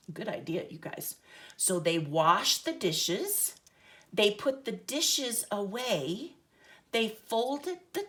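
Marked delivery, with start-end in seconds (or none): none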